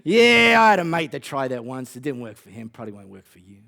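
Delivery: lightly voiced Aussie heckler, fading; Aussie accent. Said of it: "I have to do it in Aussie accent".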